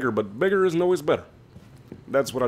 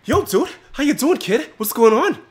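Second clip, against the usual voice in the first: much higher buddy voice; switching impression voices; 12 semitones higher than usual. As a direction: flip to a high squeaky sidekick. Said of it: "Hey, uh, Johnny".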